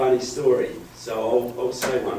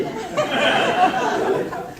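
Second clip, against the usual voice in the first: laughing. Real, not acted. Joke about the free phone.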